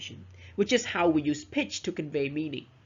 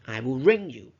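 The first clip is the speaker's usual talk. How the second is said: "ring" lifted and leaned on, then falling; stress on RING; 4 semitones lower, 4 dB louder than usual. Shown as a demonstration. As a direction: punch "ring" as a correction. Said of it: "I'm not gonna see you".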